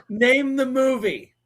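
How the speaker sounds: loud, high, drawn-out challenge. Real, not acted. Host challenging listeners to guess the quoted film, loud drawn-out call. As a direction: call it out slowly like a quiz host.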